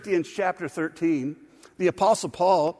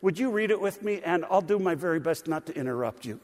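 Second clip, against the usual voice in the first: ordinary talk, nothing marked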